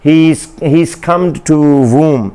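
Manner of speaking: very slowly spelled-out example line; slow example sentence. Shown as a demonstration. Teacher giving a slow example sentence to students. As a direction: draw out each word of "He, he, he succumbed to womb" for learners. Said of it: "मसलन हम कहते हैं".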